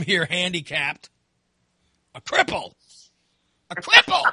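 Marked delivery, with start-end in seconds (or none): 2.1-2.7 restrained self-deprecating label; self-mocking. Self-mocking word, first take. 3.7-4.2 high, loud exaggerated repeat; hammed-up self-mockery. Self-mocking word, louder repeat.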